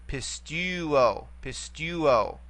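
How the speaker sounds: "pistuo" slowly, accent on the middle syllable tu; stress on middle syllable. Shown as a demonstration. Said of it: "only can go back one vowel".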